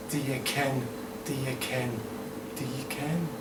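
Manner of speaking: quiet Scottish echo; Scottish accent. Acted. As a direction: softly repeat it in put-on Scots. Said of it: "do the Scottish accents".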